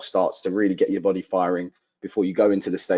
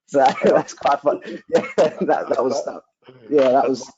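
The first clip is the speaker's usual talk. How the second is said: rueful laugh; 6 semitones higher than usual. Real, not acted. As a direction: laugh it off wryly, the pain still under it. Laughing off a painful memory.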